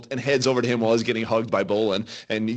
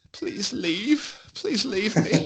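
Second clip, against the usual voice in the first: flustered servant; 8 semitones higher, much slower than usual. Acted. Embarrassed servant asking the adventurers to go, flustered and higher. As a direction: gently shoo the party out with a hint of dignity. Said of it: "a little flustered, he says".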